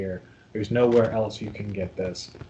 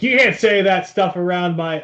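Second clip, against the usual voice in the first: a sharp, louder and higher mock scold; prudish scold; 8 semitones higher, 7 dB louder than usual. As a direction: play the prude telling people off.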